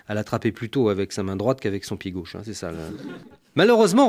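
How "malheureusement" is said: "Malheureusement" flung out high and loud, rising at the end; high rising exclamation. Retold or imitated. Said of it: "reprend la psy".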